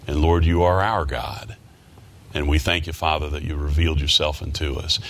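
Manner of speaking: low, earnest prayer to God; praying humbly before the congregation. Real, not acted. Pastor leading the church in prayer, reverent and low.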